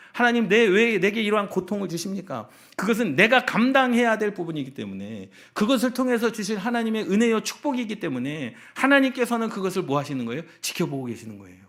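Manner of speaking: high, loud pulpit cry; impassioned preaching. Real, not acted. Pastor answers why God gives suffering, impassioned preaching. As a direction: drive it home to the congregation at full force.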